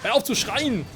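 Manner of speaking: high-pitched exasperated complaint; indignant gamer's rant; annoyed scolding. Real, not acted. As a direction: tell the enemy off, voice pushed up high. Scolding the boss mid-fight.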